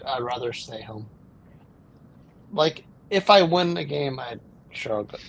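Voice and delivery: normal talking voice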